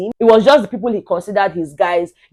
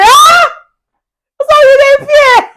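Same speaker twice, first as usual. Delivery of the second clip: very high, loud squealed taunt; hammed-up high teasing cry; mocking shriek; 7 dB louder, slower than usual. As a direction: squeal it out in exaggerated disbelief. Mocks a housemate for being afraid.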